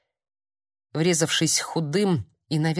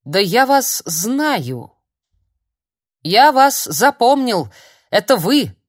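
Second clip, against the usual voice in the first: обвиняюще; 5 semitones higher, 7 dB louder, slower than usual. Acted. Старик вдруг узнаёт Филиппа.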